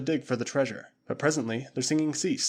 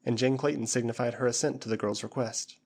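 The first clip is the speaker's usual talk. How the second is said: usual speaking voice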